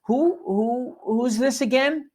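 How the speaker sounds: confused gatekeeper. Retold or imitated. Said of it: "And people will say".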